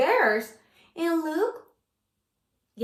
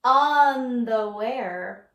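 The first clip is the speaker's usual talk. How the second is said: slow drawn-out; 3 semitones lower, much slower than usual. Shown as a demonstration. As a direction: stretch the word out slowly. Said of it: "I'm gonna read the title".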